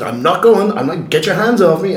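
angry refusal. Retold or imitated. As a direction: shove back, shouting him off. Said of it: "I said".

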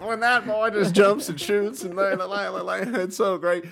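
put-on high, creaky old man marvelling; a hammed-up old-timer raving about a player. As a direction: gush like an eighty-year-old fan. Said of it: "I sound like a fucking eighty-year-old".

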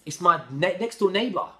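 loud, high-pitched retold shock; astonished disbelief. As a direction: blurt out the unbelievable discovery. Retelling shock at recognising the nurse.

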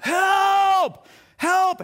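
high, drawn-out acted yell; yelling for help. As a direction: call out desperately, pitch leaping up. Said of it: "as he's yelling help".

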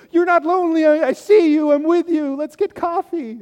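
over-the-top mock comfort; hammed-up gushing friends. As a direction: gush like everyone on the friends list at once. Imagined friends rushing to comfort.

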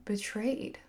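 ordinary talk

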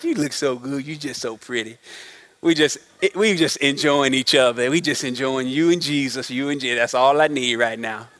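soft, low, dreamy couple's sweet talk; lovestruck cooing. Retold or imitated. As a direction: coo it like newlyweds lost in each other. Newlywed couple gazing at each other.

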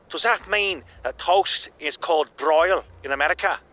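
plain reading voice

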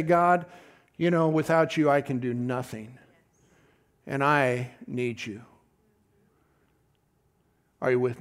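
soft, low and humble, slowing on I need you; humble prayer. Shown as a demonstration. Preacher demonstrating a humble prayer to the congregation, soft and low. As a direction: pray it quietly, low and small. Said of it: "a better attitude and a de-, better approach".

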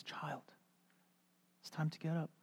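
soft, half-whispered wake-up; tender hush. Retold or imitated. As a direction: coax a sleeping child awake gently. Jesus waking someone.

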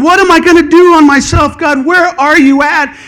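a hint of hammed-up worry; anxious plea. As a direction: plead in mock panic. Preacher acts his panicked prayer.

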